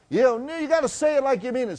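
high, fast mock teacher voice; coaxing teacher. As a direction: push her on, quick and high. Teacher [name] pushing her further.

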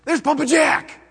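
bright call of a name at the door; a father cheering to his little son at the door, excited call; excited greeting. Retold or imitated. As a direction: call out happily, like a child's dad announcing a visitor. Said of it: "Britt will tell Isaiah".

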